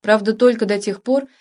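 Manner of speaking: ordinary delivery, nothing marked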